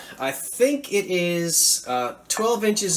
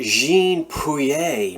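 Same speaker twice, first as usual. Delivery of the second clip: slow, showy mock-French "Jean Puyer", ending in "ay"; fake French accent. Shown as a demonstration. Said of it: "I'm throwing on my fake French accent".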